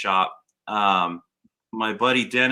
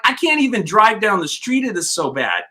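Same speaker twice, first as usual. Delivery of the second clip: much higher, louder frustrated plea; retold exasperation; 8 semitones higher, 4 dB louder than usual. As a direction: protest in disbelief. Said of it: "And I'm like".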